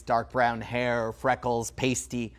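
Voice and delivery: ordinary talk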